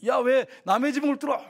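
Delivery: an indignant, put-on telling-off; mock rebuke. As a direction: scold them in mock indignation. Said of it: "이렇게 책망하지 않았어요".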